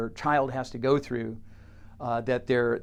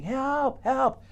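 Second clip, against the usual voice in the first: calling for help; 10 semitones higher than usual. Acted. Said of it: "he's saying".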